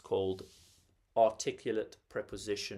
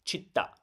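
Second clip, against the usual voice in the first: città stressed on the last syllable "ta"; final-syllable stress; 4 semitones higher than usual. Shown as a demonstration. Teacher showing a word with a stress marker, final stress. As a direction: put the weight on final "ta" in città. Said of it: "visible stress marker, like in this case".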